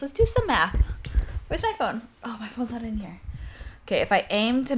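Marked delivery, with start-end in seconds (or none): 1.5-3.2 soft aside whispered to herself; hushed mumble while searching. Real, not acted. Muttered aside hunting for phone.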